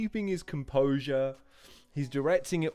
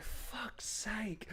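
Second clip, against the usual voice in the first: whispered; 6 semitones higher, 14 dB quieter than usual. Real, not acted. Laughing reaction to bad line.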